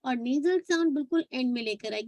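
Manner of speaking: normal talking voice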